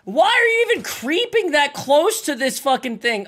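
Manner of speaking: high, loud exasperated outburst; exasperated yell. Real, not acted. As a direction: yell the question in disbelief. Yelling at enemy.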